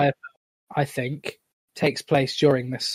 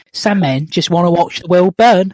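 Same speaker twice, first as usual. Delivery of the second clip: emphatic put-on film butler voice, louder; Michael Caine; 5 dB louder than usual. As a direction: deliver the line as a celebrity impression. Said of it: "get into my Michael Caine voice".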